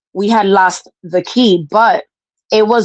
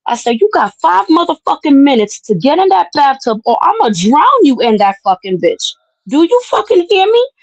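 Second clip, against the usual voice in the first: put-on high, furious yell at a mouthy kid; 8 semitones higher than usual. Aunt yelling at her disrespectful niece, furious high yell. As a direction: scream it at her, towering over her.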